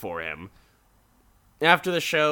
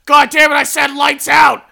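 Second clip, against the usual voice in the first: much higher, loud screaming mom; furious mom; 15 dB louder than usual. Retold or imitated. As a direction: explode at the kid at full volume. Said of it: "she's like".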